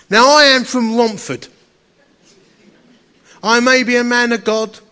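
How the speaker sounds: loud and high, a proud boast; proud declaration. Real, not acted. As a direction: belt it out with pride.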